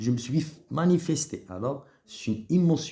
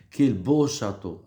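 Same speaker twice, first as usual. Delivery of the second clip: slow, louder admiring exclamation; 4 dB louder than usual. Shown as a demonstration. Teacher showing admiration in an exclamation. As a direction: marvel at the castle, slowly. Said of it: "Voilà quelques exemples".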